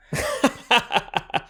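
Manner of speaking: big high cackling laugh; cackle. Shown as a demonstration. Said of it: "let's try it right now".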